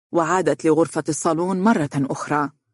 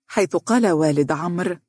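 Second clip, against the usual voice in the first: ordinary delivery, nothing marked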